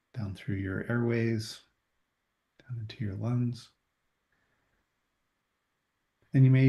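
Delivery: soft guided breathing meditation. Acted. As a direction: ease them into the breath, unhurried.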